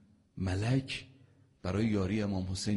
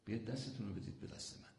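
coaxing plea; 5 semitones higher, faster than usual. Retold or imitated. Imam Hussein coaxing the people to come, gentle plea. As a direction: coax them to take his hand. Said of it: "این زبان ناز کشیدن".

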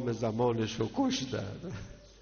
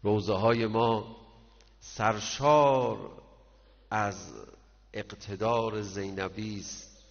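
سرشار stretched out slowly and louder; emphatic slow delivery; 6 dB louder, slower than usual. Acted. Preacher stressing Zeinab's strength to mourners, slow and heavy. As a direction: lean hard on سرشار, drawing it out. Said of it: "slow emphatic sermon line".

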